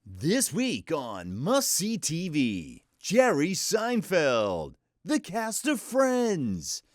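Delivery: slow, high, booming promo announcer; energetic must-see TV announcer. Retold or imitated. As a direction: sell it like a nineties network promo. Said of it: "television announcement kinda stuff".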